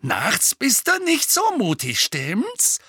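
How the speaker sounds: high-pitched taunt; mocking bully's sneer; jeering. A narrator's reading. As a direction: jab at his weak spot. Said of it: "höhnte Dudley".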